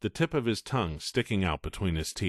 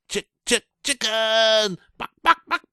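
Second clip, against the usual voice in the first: much higher, louder clucking jeer; loud chicken taunt; 14 semitones higher, 7 dB louder than usual. Acted. Coker jeering at Todd, loud chicken clucks. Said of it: "a loud cry of".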